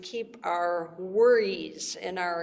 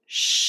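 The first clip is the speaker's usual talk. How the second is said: long loud shh; shushing sound; 6 dB louder than usual. Shown as a demonstration. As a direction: shush like at a library. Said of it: "like you're shushing somebody".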